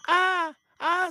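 high-pitched ah ah of surprise; high spirit cry. Acted. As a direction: yelp as it sticks to the honey. Spirit stuck on the doll.

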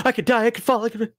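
high, hurried mock fright; inner panic. Frightened inner voice against rational brain, mock panic. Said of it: "But inside, I'm".

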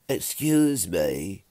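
slow, drawn-out call from below; voice drifting up from below. A narrator's reading. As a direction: call up slowly, politely interrupting. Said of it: "Sam's voice drifted up from below".

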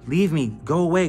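urgent retold plea. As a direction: push them away in alarm. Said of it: "in my head I said".

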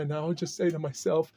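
usual speaking voice

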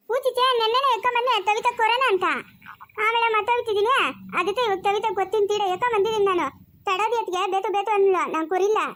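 put-on high-pitched childish lisp; lisping childlike character. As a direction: pipe up high with babyish consonants. (ತೊದಲು ಮಾತಿನ ಮಗುವಿನಂಥ ಧ್ವನಿ)

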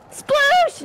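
high, loud burst of delight; excited cry. Real, not acted. Player delighted to get Sploosh, excited shout.

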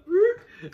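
high, loud burst of laughter; dad cracking up at his son's crash. Real, not acted.

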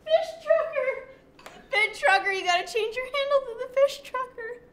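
high, loud gleeful yelling; excited teasing shout. Real, not acted. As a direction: shout the joke with delight.

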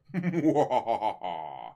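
long, descending theatrical laugh; mock sinister laugh. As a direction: laugh dramatically, sliding down in pitch. Mock-dramatic laugh after mystery question.

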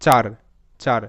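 চার said as one distinct "char" syllable, falling; demonstrated pronunciation. Shown as a demonstration. Teacher shows how the spelling sounds, careful isolated চার as "char". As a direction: say চার alone, clearly, then repeat it after a pause. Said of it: "এর উচ্চারণটা হবে".

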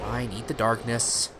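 normal talking voice

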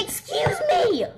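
slow, high yell of excuse me; crying shout. Acted. Doll cut off, shouting excuse me high and slow. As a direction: shout excuse me, drawing it out in frustration. Interrupted doll demands attention.

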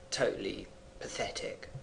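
轻声耳语; whispered aside. Real, not acted. Teacher defining deadbeat, aside.

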